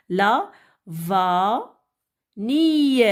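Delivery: La, va, ni with a gap after each; syllable by syllable. Shown as a demonstration. Teacher cutting words up for children, La - va - ni in isolated beats. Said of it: "découper les mots en syllabes".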